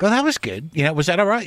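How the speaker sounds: casual co-star. Retold or imitated. Acting out composed English co-star's reply.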